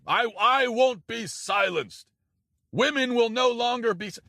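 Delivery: high, slow, hammed-up mock of Kamala Harris. Mimicking Kamala Harris for his audience, high and mocking. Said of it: "this is her favorite thing to say".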